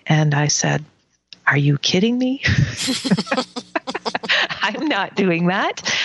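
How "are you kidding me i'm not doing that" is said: laughing refusal. Retold or imitated. Laughing refusal to grandmother in dream.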